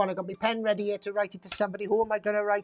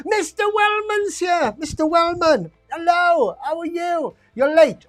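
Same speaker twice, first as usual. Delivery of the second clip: loud, high-pitched hail to a latecomer; boisterous raised-voice greeting; calling out greeting; 8 semitones higher, 7 dB louder than usual. Real, not acted. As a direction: call out a big playful welcome. Host hails a latecomer joining.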